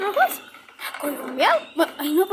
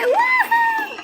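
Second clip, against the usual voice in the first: very high whooping cry; victory whoop; 5 dB louder than usual. Acted. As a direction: whoop for the win. Celebra la victoria. (grito de victoria muy agudo)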